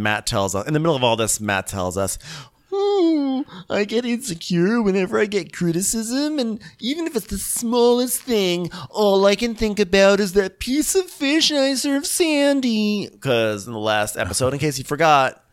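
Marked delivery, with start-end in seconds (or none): 2.7-13.1 very high whimpering lament; whiny insecure chef. Retold or imitated. Podcaster imitating chef confessing insecurity to crew, high and whiny. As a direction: pout through it like a hurt child.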